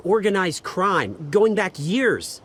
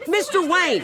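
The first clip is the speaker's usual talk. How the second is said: calling out; 8 semitones higher than usual. Acted. Reporter calling over crowd.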